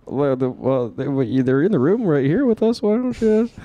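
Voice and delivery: low, put-on Peterson voice; Jordan Peterson impression. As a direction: speak like Jordan Peterson, deep.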